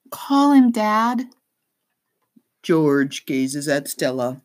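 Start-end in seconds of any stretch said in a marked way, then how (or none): 0.1-1.3 put-on high, urging girl's voice; insistent young girl. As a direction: press dad to call.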